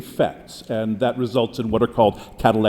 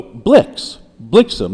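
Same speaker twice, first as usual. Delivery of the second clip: blix set apart, then blixen with BLIX stressed; louder blix, then a falling blixen; 5 dB louder, much slower than usual. Shown as a demonstration. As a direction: demonstrate the rhyme on blix. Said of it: "against the final stressed syllable".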